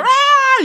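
loud high piercing scream; imitated bell scream. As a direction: shriek like the school bell. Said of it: "that loud scream at the beginning of every fucking school bell".

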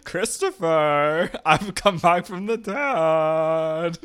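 slow, high lisping ghost. Acted. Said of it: "with a little bit of a lisp".

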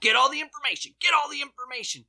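hammed-up panicked mob voice; frantic rioter. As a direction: frantically order everyone to grab the files. Rioters ransacking desks.

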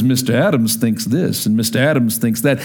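deep Southern voice. Retold or imitated. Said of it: "really deep Southern voice".

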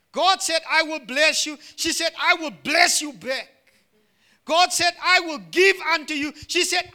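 high, loud, rhythmic pulpit delivery; impassioned preaching. Real, not acted. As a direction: proclaim each promise and answer with rising fervour. Preaches promises in call and answer.

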